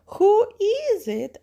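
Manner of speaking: high, loud, slow granny voice; grandmother. Acted. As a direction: call out high like a storybook granny. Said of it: "asks grandmother".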